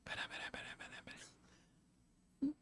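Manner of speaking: barely audible whispered babble; imitated quiet tongues. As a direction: whisper repeated syllables under your breath, imitating someone. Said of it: "Outro só faz assim". (sussurro quase inaudível, imitando)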